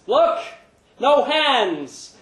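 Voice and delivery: high, loud triumphant cry; gleeful boast. Acted. Larry crowing on stage, high and loud. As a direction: crow it out like a proud kid. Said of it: "he felt like saying".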